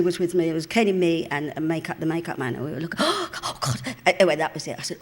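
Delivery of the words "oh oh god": high, gasped mock horror; aghast. Three onlookers reacting to what they saw, aghast. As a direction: squeak it out after a sharp gasp. Acts out shocked onlookers on set.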